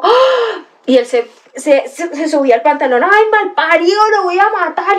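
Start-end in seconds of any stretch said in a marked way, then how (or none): 0.0-0.7 sudden high shocked "ah"; startled gasp. Retold or imitated. Streamer telling chat how she froze, high startled "ah". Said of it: "yo quedé como". 3.0-5.0 high, hammed-up furious threat; mock angry threat. Streamer quoting the humiliated boy, mock furious threat. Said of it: "se enojó un montón".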